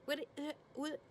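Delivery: high, stammering mock questions; flustered questioning. Megan voiced firing flustered questions.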